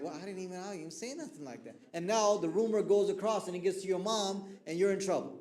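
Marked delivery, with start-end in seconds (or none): 0.0-1.8 softer, faster flustered protest; defensive denial. Retold or imitated. Accused teen denies the rumor, softer and quicker. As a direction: brush off the rumor under your breath, quickly. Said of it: "And you're like".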